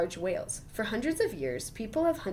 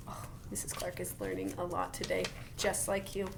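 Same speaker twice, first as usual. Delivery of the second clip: whispered aside; 7 dB quieter than usual. Real, not acted. Teacher whispering a secret aside to the child.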